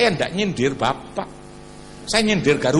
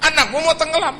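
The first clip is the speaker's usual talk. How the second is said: louder preaching to the congregation; forceful preaching; 6 semitones higher, 5 dB louder than usual. Acted. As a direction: push it out over the crowd. (khotbah lantang kepada jemaat)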